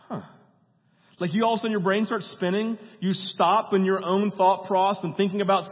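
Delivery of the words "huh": dawning huh. Shown as a demonstration.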